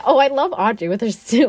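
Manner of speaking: ordinary talk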